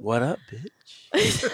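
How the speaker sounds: very slow, drawn-out ad-lib; hyped-up ad-lib. Pretend. Host doing background ad-libs, slow and hyped-up. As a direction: stretch it out like a background hype line. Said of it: "With the Chris Mack ad libs".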